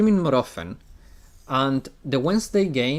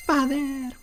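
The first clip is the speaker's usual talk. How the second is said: put-on girl's voice; 11 semitones higher than usual. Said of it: "I have no talent for".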